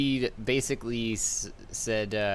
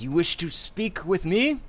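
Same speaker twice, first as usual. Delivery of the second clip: slow, higher, commanding guild leader; villain voice; 10 semitones higher, 6 dB louder than usual. Acted. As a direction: speak as a haughty guild master. DM voices Ferris.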